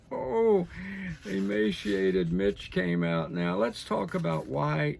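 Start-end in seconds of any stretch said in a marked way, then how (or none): 0.0-3.3 laughing mockery. Real, not acted.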